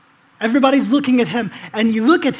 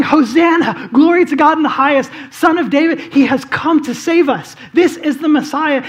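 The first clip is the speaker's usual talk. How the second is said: retold crowd shouting; 5 semitones higher, 6 dB louder than usual. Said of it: "they're all shouting and screaming".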